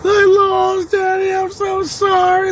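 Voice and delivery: very high mock wail; imitated crying child. Losing boy apologizing to his daddy, imitated crying. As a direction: wail it like a sobbing kid. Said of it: "He cried".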